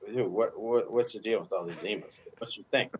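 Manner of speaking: lightly voiced puzzled teammate; Jamie McClenn. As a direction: imitate a baffled buddy, low-key. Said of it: "Jamie McClenn calls me".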